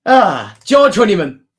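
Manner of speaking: loud, high excited call of the name. Real, not acted. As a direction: burst out with the name. Excited exclamation.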